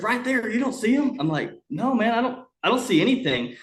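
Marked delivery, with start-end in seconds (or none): 0.0-1.2 much higher pitch, urging; imitated urgent insistence. Dad showing the hunter the pigs, imitated urgent insistence. 1.7-3.6 higher-pitched denial; retold baffled protest. Hunter answering dad's sighting, retold baffled protest.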